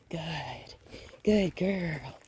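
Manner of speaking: soft unvoiced praise to a dog; whispered praise. Real, not acted. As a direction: breathe the praise, barely voiced.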